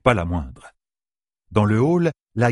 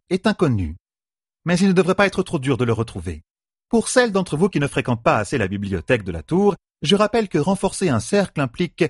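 higher-pitched professor addressing a class; determined lecturer; 6 semitones higher than usual. A narrator's reading. A sister addresses her peers like a professor, determined. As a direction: lecture them with full determination. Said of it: "La voix pleine de détermination, elle s'exprima comme un professeur".

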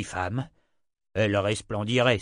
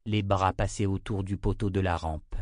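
usual speaking voice

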